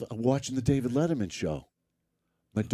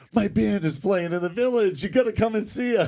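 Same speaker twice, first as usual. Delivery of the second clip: excited; 11 semitones higher, 4 dB louder than usual. Retold or imitated. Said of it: "I'm like, first thing that comes".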